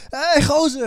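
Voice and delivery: high-pitched acted greeting; calling back. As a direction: imitate a cyclist hailing back.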